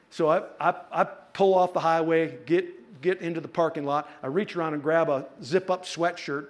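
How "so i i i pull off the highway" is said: ordinary delivery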